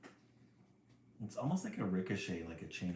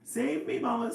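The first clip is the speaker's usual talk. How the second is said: very high, loud squeak; tiny bird squeaking for its mother; baby bird squeaks to its mother for help; 8 dB louder than usual. A narrator's reading. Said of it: "squeaks Baby Jay".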